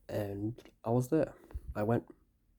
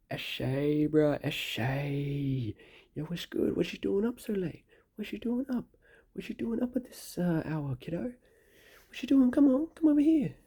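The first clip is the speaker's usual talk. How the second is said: shouted eshay lad, pitched way up; aussie eshay slang; 9 semitones higher, 6 dB louder than usual. Retold or imitated. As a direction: yell it across the road like a lairy eshay. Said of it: "he shouted across the road".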